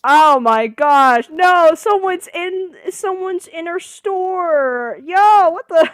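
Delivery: screaming high and loud in shock; shrieking oh my gosh; panicked scream. Real, not acted. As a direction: yell out in real alarm at the break-in. Jump scare: break-in in store.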